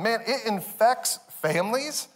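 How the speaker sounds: normal talking voice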